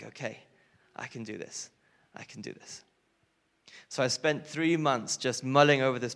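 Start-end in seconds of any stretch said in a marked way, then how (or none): 0.0-2.8 hushed, almost whispered "I can do this"; whispered pep talk to yourself, much softer; quiet self-reassurance. Retold or imitated.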